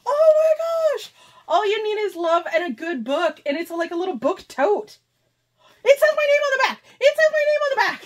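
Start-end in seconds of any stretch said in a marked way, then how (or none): 0.1-1.1 high, loud squeal of delight; thrilled. Real, not acted. Delighted by personalized gift. 5.9-8.0 very high, loud squeal; real overjoyed high-pitched squealing; ecstatic. Real, not acted. Finds own name on gift.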